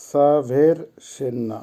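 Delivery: সাভের সেন্না slowly broken into separate syllables; slow teacher's model of সাভের সেন্না; pronunciation demo. Shown as a demonstration. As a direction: spell out সাভের সেন্না by sound, pausing between parts. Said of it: "উচ্চারণ হবে".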